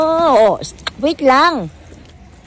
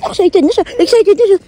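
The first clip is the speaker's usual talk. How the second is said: high, much louder gushing; excited squeal; 8 semitones higher, 7 dB louder than usual. Real, not acted. Mother squealing over baby.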